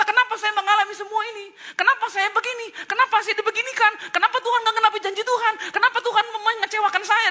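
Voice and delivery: high, loud, laughing whiny complaints; mock complaining believer. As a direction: whine at God, half-joking. (jemaat mengeluh pada Tuhan, lucu)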